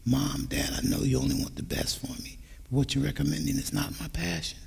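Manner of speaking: soft, low, unhurried plea; low and slow. Shown as a demonstration. Teen respectfully telling parents no, low and slow. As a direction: keep it quiet and respectful, no pushing back. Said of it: "Talk low and slow".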